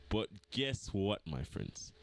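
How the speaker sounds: smug. Real, not acted. Smug low reveal.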